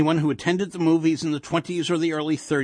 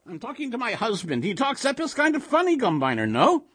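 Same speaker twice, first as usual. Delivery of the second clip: quick, high Yiddish-inflected chatter; sing-song old woman; dismissive old wife; 11 semitones higher than usual. A narrator's reading. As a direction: brush the stranger off and consult your husband. Wife dismisses monster, asks husband.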